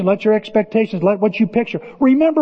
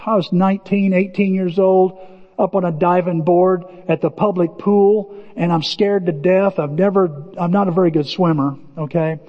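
normal talking voice

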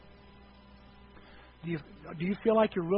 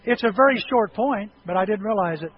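ordinary talk, nothing marked

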